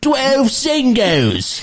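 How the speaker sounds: high, drawn-out British announcer impression; over-the-top British TV voice, very high and slow; mock British accent. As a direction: stretch it out in a hammed-up British accent. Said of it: "my interpretation of, uh, British".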